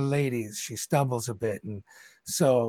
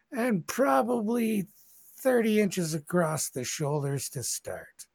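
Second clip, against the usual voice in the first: ordinary talk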